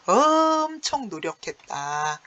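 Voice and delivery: emphatic slow. Shown as a demonstration. Teacher stressing the point.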